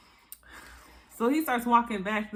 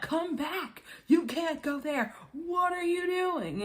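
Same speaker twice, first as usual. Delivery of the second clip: high-pitched imitation of frantic hollering; mock calling out; 9 semitones higher, slower than usual. Production crew calling back a cast member, mock hollering. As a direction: call after someone, pitched high and drawn out.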